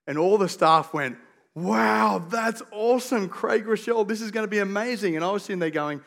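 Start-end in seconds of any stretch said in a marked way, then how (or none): 1.5-5.1 imitating awestruck colleagues, pitched high; excited awe. Church staff thrilled about the conference guest, excited awe.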